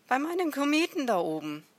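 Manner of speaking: high, loud, quick insistence; emphatic repetition. A narrator's reading. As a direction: repeat it forcefully for someone who didn't get it. Repeats emphatically after being questioned.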